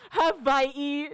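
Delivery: "Hawaii" said ha-VAI-ee with a v; mock a pedant saying "Hawaii" with a v; "Hawaii" as huh-VY-ee, exaggerated; mocking over-correct pronunciation.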